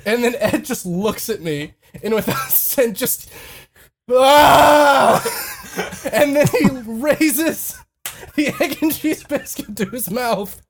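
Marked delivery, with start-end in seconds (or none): 4.1-5.2 long, loud mock scream; higher, drawn-out imitated roar of frustration; frustrated yell. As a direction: let out a comic exasperated yell. Teller mimics friend's angry yell.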